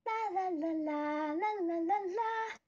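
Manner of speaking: chirpy cartoon singing; Petunia voice. Streamer shows chat how Petunia sings, high and chirpy. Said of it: "Petunia is like".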